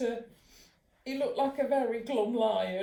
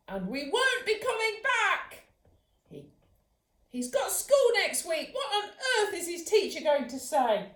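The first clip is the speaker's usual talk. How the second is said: scolding mum; 9 semitones higher, 5 dB louder than usual. Acted.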